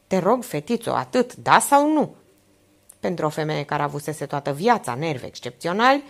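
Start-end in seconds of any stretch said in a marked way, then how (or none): 0.1-2.1 worried plea, mildly done; pleading. A narrator's reading. Aunt begs her niece for an answer, pleading. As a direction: plead gently, rising and slowing on the last question. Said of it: "tonul rugător, privirea sincer îngrijorată".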